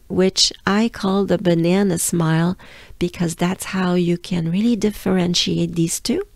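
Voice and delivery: ordinary talk, nothing marked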